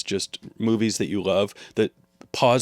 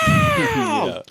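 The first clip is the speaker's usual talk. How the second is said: high, loud screaming yell; CSI scream; 5 dB louder than usual. Retold or imitated. Imitating CSI theme scream.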